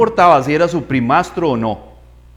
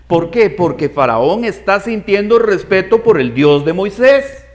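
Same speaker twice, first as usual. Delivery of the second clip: ordinary talk, nothing marked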